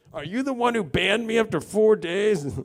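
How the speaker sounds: a hammed-up suspicious date; mock accusation. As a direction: accuse the date half-jokingly.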